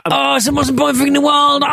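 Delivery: high, over-the-top exclamation; mock outrage. Co-host mocking a motorbike stipulation, hammed-up excitement. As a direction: blurt it out as if it matters hugely. Mocks the motorbike's sudden importance.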